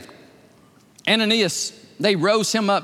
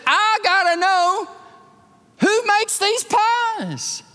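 high, slow, drawn-out plea; eager preacher demand; much slower than usual. Retold or imitated. Preacher bursts into the kitchen, eager and high. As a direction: stretch it out, eager to meet the baker. Pastor demanding to meet the baker.

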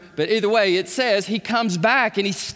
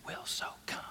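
whispered, voiceless hint; 19 dB quieter than usual. Shown as a demonstration. Preacher whispering the answer to the congregation. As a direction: breathe the hint to the crowd. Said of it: "just give you a hint".

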